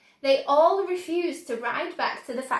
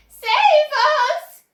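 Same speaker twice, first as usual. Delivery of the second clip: put-on a very high, loud old woman's shriek; terrified cry; 11 dB louder than usual. A narrator's reading. Grandma Josephine crying out as the roof caves in, terrified. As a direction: scream for rescue in panic. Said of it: "cried Grandma Josephine".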